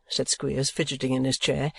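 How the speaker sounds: usual speaking voice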